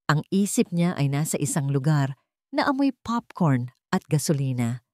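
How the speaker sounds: ordinary delivery, nothing marked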